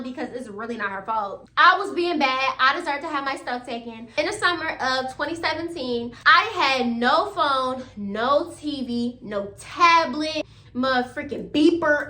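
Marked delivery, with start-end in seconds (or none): 6.3-12.1 each item drawn out slowly; slow emphatic list. Acted. Teen listing what mom took, slow and emphatic. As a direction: drag out every missing thing. Listing everything taken away.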